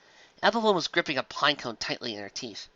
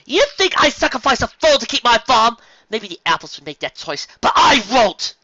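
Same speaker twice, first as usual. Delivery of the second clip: much higher, louder outraged yell; 9 semitones higher, 9 dB louder than usual. Acted. Carrot Top yells at Flam, outraged. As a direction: yell your refusal. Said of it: "yelled Carrot Top".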